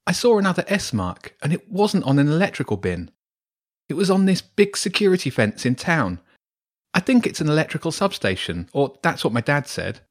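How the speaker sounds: Wilf's eager voice. Acted. Boy telling friends about a strange mark, eagerly. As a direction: chatter on like an excited boy.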